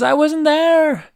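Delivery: high, slow pleading giraffe voice; giraffe's plea. Retold or imitated. Accused giraffe denies it to the officer, high and slow. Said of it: "And he says".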